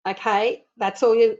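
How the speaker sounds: ordinary talk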